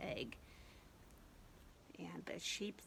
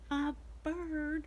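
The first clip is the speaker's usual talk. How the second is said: mock surprise; 7 semitones higher than usual. Surprised discovery for kids.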